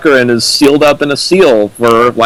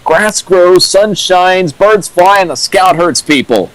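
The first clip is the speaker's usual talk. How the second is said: slow, higher sing-song listing of the obvious; sarcastic; 7 semitones higher than usual. Retold or imitated. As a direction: spell out the obvious as if to a child. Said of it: "And I said".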